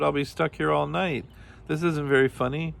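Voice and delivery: high-pitched, plaintive storybook crying; sobbed. A narrator's reading. Kangaroo fears a night stuck inside, mildly sobbed. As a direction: wail it mildly, without real tears. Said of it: "he sobbed".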